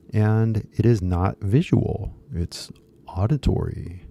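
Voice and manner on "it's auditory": hushed whisper for emphasis. Real, not acted. Teacher whispering a key point to learners. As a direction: whisper it's auditory like a secret.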